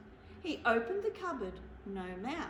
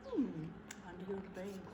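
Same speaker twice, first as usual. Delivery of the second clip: grumpy bear muttering; 5 semitones lower, 6 dB quieter than usual. Acted. Bear muses behind his door.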